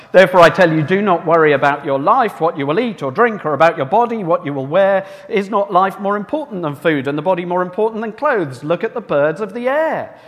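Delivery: loud, declaiming Bible reading; preacher reading scripture aloud with force. Acted. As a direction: proclaim the verses to the congregation. Reads Jesus's words aloud.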